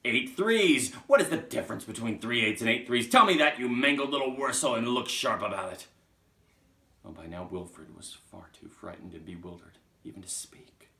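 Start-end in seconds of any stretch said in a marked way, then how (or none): 0.0-5.9 loud, raised, hectoring rant; furious yelling. Acted. Trunchbull lecturing Wilfred on multiplication, furious yelling. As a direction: tear into the pupil at full volume. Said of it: "yelled the Trunchbull".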